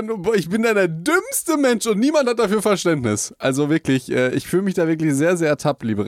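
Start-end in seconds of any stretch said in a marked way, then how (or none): 0.0-3.3 mock despair. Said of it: "weil du denkst dir".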